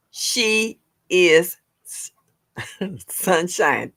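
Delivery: "she is sunshine" drawn out with gaps; slow, halting "she is... sunshine"; careful slow name. Real, not acted. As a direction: stretch "she is", stumble, laugh into "sunshine". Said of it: "I cannot say that fast for some reason".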